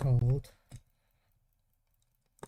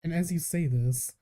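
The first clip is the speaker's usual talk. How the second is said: normal talking voice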